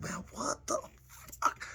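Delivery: breathy whisper pitched high and slowed; whispered inner thought. Retold or imitated. His own stunned thought while getting choked, in a whisper. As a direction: whisper it to yourself, stunned and slow.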